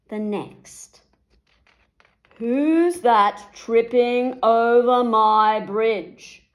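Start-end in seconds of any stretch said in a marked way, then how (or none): 2.3-6.4 slow, loud troll roar; troll challenging whoever crosses the bridge. A narrator's reading. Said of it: "roared the troll".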